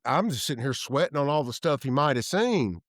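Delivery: ordinary talk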